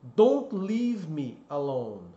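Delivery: "Don't leave me alone" slow, long ee in "leave"; slow model sentence. Shown as a demonstration. Teacher giving the song-like example slowly. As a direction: say each word slowly and clearly.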